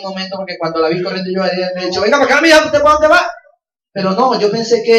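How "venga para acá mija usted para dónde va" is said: put-on higher, louder call after a child. Father shouting after his daughter running off, loud call. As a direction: call her back across the crowd.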